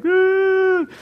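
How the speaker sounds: loud, high-pitched mock crying howl; hammed-up bawling on one long high note; mock wail. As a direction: wail out an exaggerated sob. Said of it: "flat out crying".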